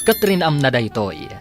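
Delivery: ordinary talk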